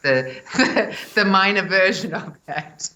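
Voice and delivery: laughing through the words. Real, not acted. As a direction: laugh it out, amused at yourself. Laughing about own accent.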